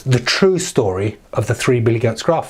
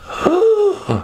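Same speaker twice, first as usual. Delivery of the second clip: high acted gasp; shocked gasp. Troll faced by big goat, high mock gasp.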